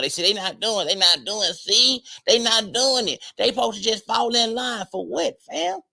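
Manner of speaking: high, mocking imitation of complaining men; mocking whine.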